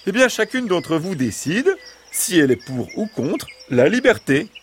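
high-pitched fox voice explaining the vote; persuasive fox. Acted. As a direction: put it to the hens in a high fox voice.

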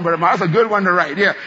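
usual speaking voice

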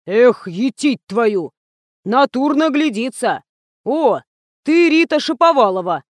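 put-on old man exclaiming, drawn-out start; old watchman. A narrator's reading. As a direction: puzzle it out, then announce it triumphantly. Old guard exclaims on recognizing her.

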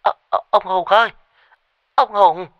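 high, strained cry down the phone; stammer, then call out shrilly in alarm; stammered yelling. A narrator's reading. Tea-seller calls the husband by phone, stammering and yelling. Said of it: "gào vào như muốn bục cả màng nhĩ".

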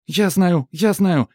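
put-on high, loud squealing girl; cartoon princess. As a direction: squeal like a bratty little girl. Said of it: "верещит принцесса ... как капризная маленькая девочка". (капризная принцесса визжит)

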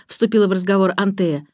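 ordinary delivery, nothing marked

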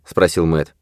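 plain reading voice

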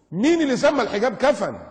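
loud, high outraged challenge; indignant. Real, not acted. Preacher challenges those who call hijab a shroud, indignant. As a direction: demand it loudly, pitched high.

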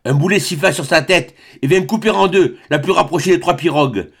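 ordinary delivery

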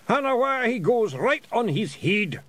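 yelling. A narrator's reading. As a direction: bellow it as the elf flies. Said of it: "he yelled".

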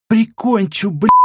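high-pitched, furious cursing yell; enraged threat. A narrator's reading. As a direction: threaten to finish him off.

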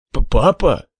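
shocked, stammering and choking. Acted. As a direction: sputter it out in disbelief. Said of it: "подавился и зашёлся кашлем".

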